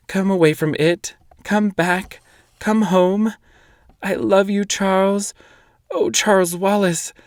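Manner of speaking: high, pleading through tears; tearful pleading. A narrator's reading. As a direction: beg him to come back, crying. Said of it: "Tears were streaming down her cheeks".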